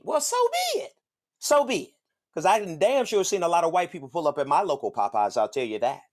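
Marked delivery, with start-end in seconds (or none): 0.0-1.9 high mock shrug. Mock resigned shrug, pitched up.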